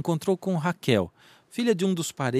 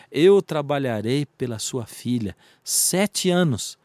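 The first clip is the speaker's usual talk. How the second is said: slow, deliberate pledge; slow declaration; 3 dB louder, slower than usual. Acted. Jacob offers his father-in-law seven years of work, slow and deliberate. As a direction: declare it with weight, taking your time.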